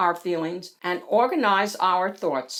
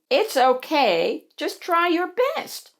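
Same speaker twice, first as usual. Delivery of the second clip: high, encouraging character voice; reassuring Bonnie; 8 semitones higher than usual. Acted. Bonnie comforting nervous Hattie, high and reassuring. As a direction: soothe in a high little voice.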